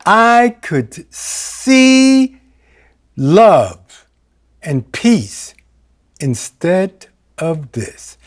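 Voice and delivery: slow, reverent recital with long pauses; slow recitation. Shown as a demonstration. Quoting a line from the Course in Miracles, slow and reverent. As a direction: savour each word slowly. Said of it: "a line from the Course in Miracles".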